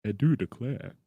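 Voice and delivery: lightly drawled snooty gentleman; snooty southern gentleman. Acted. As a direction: play a prissy southern gent. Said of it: "snooty voice".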